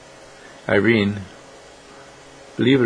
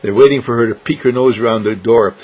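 ordinary delivery, nothing marked

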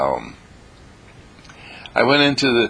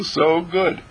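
ordinary talk, nothing marked

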